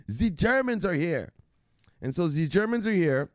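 proud hammed-up German announcement; mock German accent.

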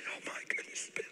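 soft, voiceless whispered exclamation; hushed retold gasp of words; tearful whisper. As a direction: whisper it, overcome with emotion. Retelling his tearful, overwhelmed reply.

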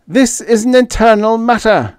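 high-pitched, loud alien cry; Fifth. A narrator's reading. Fifth shutting out questions about the government, crying out. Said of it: "Fifth cried in his faint Korakian voice".

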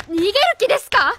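loud, high, fast challenge; sharp accusation. Acted. Teacher confronts the man as he edges away. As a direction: catch him trying to slip out.

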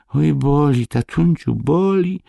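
put-on very high, whimpering child's voice; storyteller acting a boy about to cry; near tears. A narrator's reading. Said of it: "do płaczu się krzywić". (płaczliwy, wysoki głos dziecka)

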